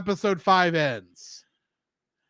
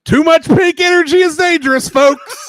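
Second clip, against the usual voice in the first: loud, high hammed-up public warning; mock warning; 10 semitones higher, 11 dB louder than usual. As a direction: announce it like a safety PSA.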